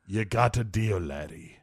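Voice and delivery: understated menace; mock menacing. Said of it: "saying it all menacing like that".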